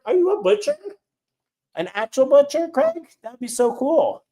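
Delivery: high, eager questions; thrilled to meet a real butcher; bright rising excitement, well up in pitch; excited. Real, not acted. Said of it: "Okay, I'm so excited".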